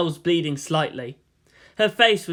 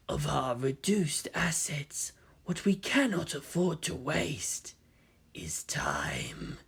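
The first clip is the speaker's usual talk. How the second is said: slow, much softer sinister whisper; intoned whisper; 9 dB quieter, slower than usual. Acted. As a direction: hiss it from the shadows. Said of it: "he intoned".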